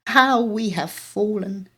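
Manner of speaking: mild theatrical lament, slow; self-pitying lament. Acted. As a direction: sigh it out like a wounded grandmother. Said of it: "she would pause to reflect".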